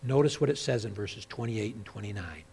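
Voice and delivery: ordinary talk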